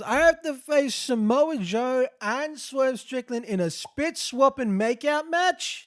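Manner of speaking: high, loud, slow indignant whine; hammed-up Hangman complaining; mock Hangman outrage. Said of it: "He goes".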